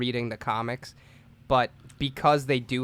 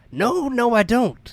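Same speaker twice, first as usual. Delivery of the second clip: higher, louder mocking voice; mock high denial; 9 semitones higher, 4 dB louder, slower than usual. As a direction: whine the denial back.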